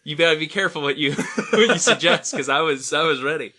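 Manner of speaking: loud, laughing through the words; giggling while admitting eagerness. Real, not acted. Joking about pirate voice.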